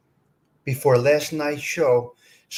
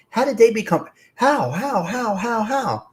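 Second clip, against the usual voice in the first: mock puzzled; 6 semitones higher than usual. Exasperated repeated questioning.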